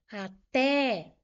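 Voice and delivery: "Até" with the final TÉ punched; stress on TÉ. Shown as a demonstration. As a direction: lean hard on the second syllable of "Até". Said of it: "o té é a sílaba forte". ("Até" com força no TÉ)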